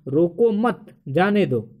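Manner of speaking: slow, with a break after "मत"; comma pause. Shown as a demonstration. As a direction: mark the comma between "मत" and "जाने दो". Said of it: "यहाँ पे अल्प विराम".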